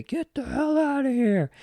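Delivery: high, put-on voice barking her out the door; a hammed-up get out of here; mock Shep kicking her out. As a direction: imitate the big guy ordering her out. Shep throws the woman out.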